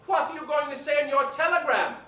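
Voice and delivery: Betty Davis asking. Retold or imitated. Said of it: "turned to her and said".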